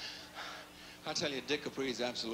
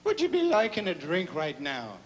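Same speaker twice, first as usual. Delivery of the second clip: much higher, louder brogue; Irish priest; 9 semitones higher, 8 dB louder than usual. Acted. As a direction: offer the bishop a drink in a brogue. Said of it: "He says".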